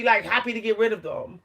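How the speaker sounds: ordinary talk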